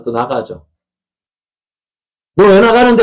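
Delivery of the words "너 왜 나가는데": high, loud challenge; angry demand. Acted. Parent demanding why the child is leaving, angry demand. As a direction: confront them in a raised voice.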